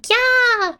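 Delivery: high excited shriek. Acted. Girl in the background at fireworks, shrieking. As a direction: squeal it out high, like a thrilled onlooker. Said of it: "someone shrieking".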